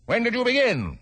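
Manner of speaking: high, sharp royal question; pompous king. Acted. King voiced.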